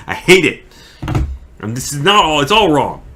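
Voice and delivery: loud, high exasperation; frustrated outburst. Real, not acted. Actor hating his own take mid-monologue, loudly exasperated. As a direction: burst out in frustration at yourself.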